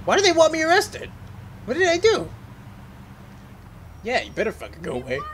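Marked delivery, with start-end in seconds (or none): 0.1-2.3 very high, indignant squeal; mock innocent protest. Playing innocent to game police.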